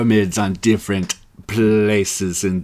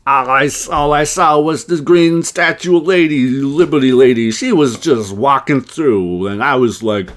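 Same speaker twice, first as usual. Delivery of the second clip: higher, louder, slower put-on New York accent; 6 semitones higher, 8 dB louder, slower than usual. New Yorker on the TV news, in a hammed-up New York accent. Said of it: "We got, like, a New Yorker".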